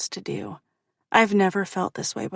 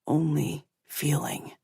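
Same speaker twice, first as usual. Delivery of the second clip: low male voice; 4 semitones lower, 4 dB quieter, slower than usual. A narrator's reading. He continues low.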